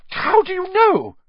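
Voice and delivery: sudden high startled burst; shocked, loud splutter. A narrator's reading. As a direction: blurt it out in shock. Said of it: "she spluttered".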